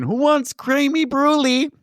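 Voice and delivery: high singsong mom offering dessert; mock high-pitched hostess call; cheery mom. Acting mom calling out dessert.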